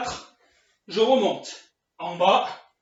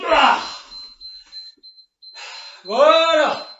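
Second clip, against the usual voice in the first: loud relieved exhale; 6 semitones higher, 6 dB louder, much slower than usual. Real, not acted. Relief after the set.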